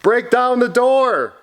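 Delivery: high storybook order, not shouted; high-pitched exclaimed command; urgent command. Acted. Story character gives urgent order.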